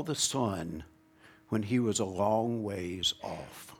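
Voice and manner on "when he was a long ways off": normal talking voice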